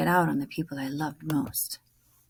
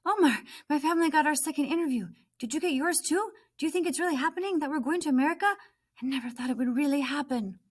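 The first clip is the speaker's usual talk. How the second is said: high, fast, bubbling with excitement; excited news; 9 semitones higher, faster than usual. Acted. Nemo telling Omar her family got an interview. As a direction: rush out the good news.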